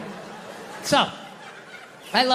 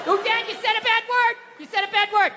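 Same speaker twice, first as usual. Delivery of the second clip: put-on much higher, a tattling child voice; imitating his little daughter; 12 semitones higher than usual. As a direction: catch Dad swearing, a little kid singing it out. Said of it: "that cute voice".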